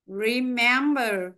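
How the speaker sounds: Remember said very slowly, syllable by syllable; stress demo. Shown as a demonstration. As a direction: stretch out Remember to show the stress. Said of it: "cô đọc và các bạn theo dõi". (đọc thật chậm từ Remember để nghe trọng âm)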